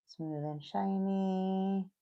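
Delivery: very slow, soft, drawn out; slow hushed singsong; savoring the words. Real, not acted. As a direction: stretch it out softly while absorbed in the work. Repeats it slowly while working.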